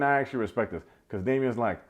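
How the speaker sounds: normal talking voice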